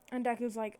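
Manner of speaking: ordinary delivery, nothing marked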